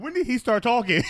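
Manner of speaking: high, loud startled question; mock astonishment. Mother hears her little son talking, loudly astonished. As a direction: play a stunned mother, voice shooting up.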